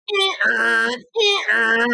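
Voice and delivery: mock alarm beep, eh-er; imitated remote beeping. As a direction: mimic the remote's warning beep. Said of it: "damn remote going".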